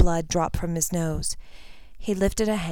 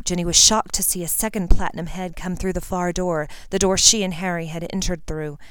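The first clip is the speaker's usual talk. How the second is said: plain reading voice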